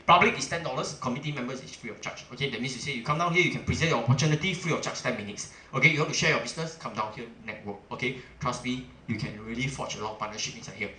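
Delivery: ordinary delivery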